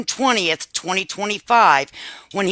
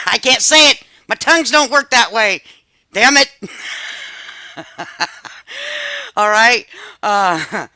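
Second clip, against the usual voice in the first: laughing loudly through the words, giving up; 5 semitones higher, 5 dB louder than usual. Real, not acted. Host laughing at stumbling over the name, laughing. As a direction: laugh it off, a bit louder than usual.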